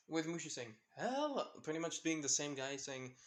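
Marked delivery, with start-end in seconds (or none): 1.0-1.4 a high, bouncy hello. Retold or imitated. Mushu greeting Izuku, high energetic hello. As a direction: burst in bright and eager like a tiny dragon. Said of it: "the energetic guy".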